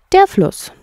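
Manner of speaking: "Der" punched high and loud; correcting stress. Acted. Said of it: "korrigierte Ratte". (korrigierend, "Der" stark betont)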